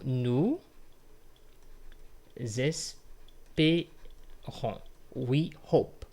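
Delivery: "nous espérons" very slowly, broken into syllables with long pauses. Shown as a demonstration. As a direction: draw out "nous", then place each syllable of "espérons" separately. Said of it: "We need to correct this".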